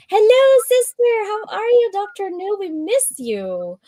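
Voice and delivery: very high, singsong squeal that eases down; excited greeting. Real, not acted. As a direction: greet a missed friend in a delighted high voice. Calls out greetings to arriving viewers.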